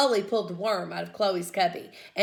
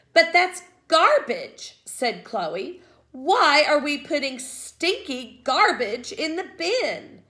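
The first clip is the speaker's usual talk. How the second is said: whiny objection; 6 semitones higher, 4 dB louder, slower than usual. A narrator's reading. Girl objects to garbage.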